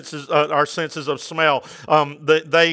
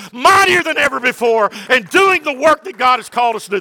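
louder, higher, fervent proclamation; full-force preaching; 7 semitones higher, 4 dB louder than usual. Real, not acted. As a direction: build to a rousing sermon climax.

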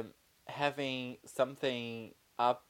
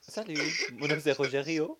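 French accent, Rogerio; 5 semitones higher, 3 dB louder than usual. Shown as a demonstration. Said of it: "Or French?".

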